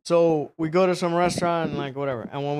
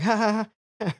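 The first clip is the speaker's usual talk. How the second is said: higher-pitched put-on ha-ha; mock laugh; 5 semitones higher than usual. Passenger told the hut is the airport, mock laughing. His reply to a joke claim.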